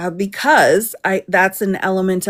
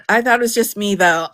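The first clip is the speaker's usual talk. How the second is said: ordinary delivery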